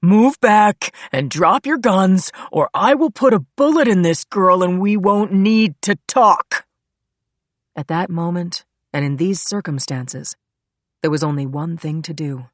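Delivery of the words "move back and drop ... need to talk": slow loud threatening order; menacing threat. A narrator's reading. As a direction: threaten them with a gun. Hostage-taker threatens the police.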